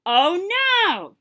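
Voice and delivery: loud, high bellow. Acted. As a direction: bellow it out in alarm. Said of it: "bellowed the tiger".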